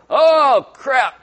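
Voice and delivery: high loud startled yelp; startled donkey. Acted. Donkey squeezing Balaam against the wall, loud startled outburst. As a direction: blurt it out as a cornered donkey. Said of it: "So the donkey's like".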